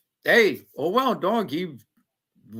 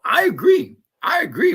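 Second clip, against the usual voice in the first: higher, louder, eager agreeing; emphatic agreement; 13 semitones higher, 7 dB louder than usual. Real, not acted. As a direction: jump in to agree. Agreeing with chat.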